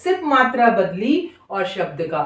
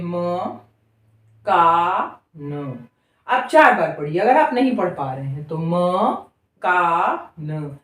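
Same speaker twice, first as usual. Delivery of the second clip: "म का न" said slowly, letter by letter, lower; slow spelled-out demo; 4 semitones lower than usual. Shown as a demonstration. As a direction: sound out each letter slowly. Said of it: "जैसे मैं पढ़ रही हूँ बिल्कुल वैसा पढ़िए". ("म का न" को अक्षर-अक्षर धीरे पढ़ना)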